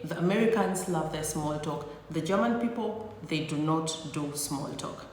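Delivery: normal talking voice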